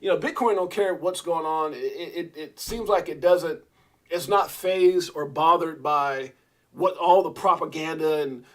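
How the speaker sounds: usual speaking voice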